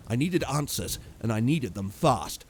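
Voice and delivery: usual speaking voice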